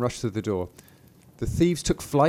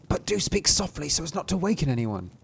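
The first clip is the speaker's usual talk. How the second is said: higher-pitched urgent hushing voice; alarmed thieves; 5 semitones higher than usual. Acted. Thieves beg Thumbling not to waken anyone, urgently. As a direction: voice the nervous thieves, raised in pitch.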